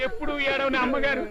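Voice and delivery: hammed-up sobbing in support of the lady; comic crying. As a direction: whimper rising to a high wail. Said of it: "నువ్వెందుకు ఏడుస్తున్నావురా".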